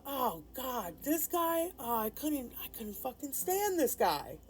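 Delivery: imitating uncle blurting it out loudly, pitch up; a loud, disgusted outburst; mock loud disgust. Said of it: "real loud".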